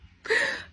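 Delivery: a very high, choked laugh breaking into tears; an emotional crying laugh; teary laugh-sob. Real, not acted. Moved by mom's words.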